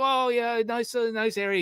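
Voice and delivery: high imitation of a Scottish accent; imitated Scottish accent. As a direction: pitch it way up as a chatty Scotsman. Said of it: "coming out with his accent".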